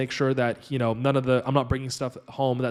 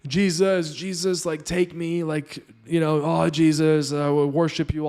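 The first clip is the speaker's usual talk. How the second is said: mock ecstatic prayer, pitched up; imitating ecstatic worship; 4 semitones higher than usual. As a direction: ham up her rapturous praying to Jesus. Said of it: "and she's like".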